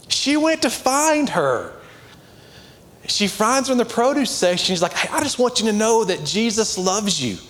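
high-pitched, emphatic sermon delivery; fired-up preaching. Real, not acted. Preaches with raised voice.

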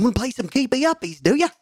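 high-pitched Aussie cartoon dog voice; blue heeler dog. Little blue heeler greeting Noah's animals, mock Aussie dog voice. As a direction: put on a high, playful Australian dog. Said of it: "blue heeler dog going".

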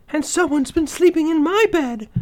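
medium-sized bear voice, pitched well up; high storybook she-bear; Mama Bear. A narrator's reading. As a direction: exclaim as an indignant mother bear. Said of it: "exclaimed in her medium-sized voice".

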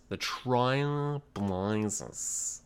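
put-on Australian accent. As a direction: let the show name fade out, still Aussie. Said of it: "very Australian".

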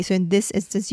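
normal talking voice

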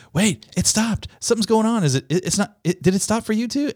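high-pitched alarmed questioning; flustered, stumbling, pitched right up; panicked. Retold or imitated. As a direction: imitate a colleague starting to panic. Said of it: "there was a moment of panic".

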